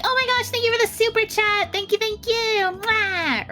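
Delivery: cute anime streamer. Retold or imitated. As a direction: gush over a super chat, blow a kiss. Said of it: "when you see VTubers be like".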